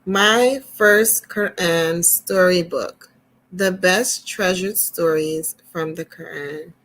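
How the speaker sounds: gentle storyteller reading to little ones; storybook reading. Acted. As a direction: read it like a picture book to kids. Reads storybook to children.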